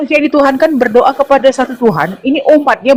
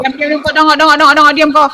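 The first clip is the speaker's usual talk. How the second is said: shouting insults; angry shout; 4 semitones higher than usual. Acted. Speaker yelling at a heckler, angry. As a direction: yell at him to shut up.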